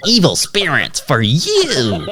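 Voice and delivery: high, slow, laughing curse; gleeful spooky curse; laughing witch. Pretend.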